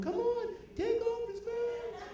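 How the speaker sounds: very high, slow squeaky fan voice; excited wrestling fan. Retold or imitated. A fan at the wrestling match begging, very high voice.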